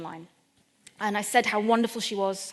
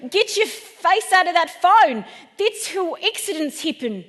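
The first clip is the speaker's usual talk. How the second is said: high, loud scolding shout that eases off; shouting builder telling someone off; builder's shout; 7 semitones higher, 7 dB louder than usual. Retold or imitated. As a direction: holler a slow warning, then ease down. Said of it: "this builder shouted at me".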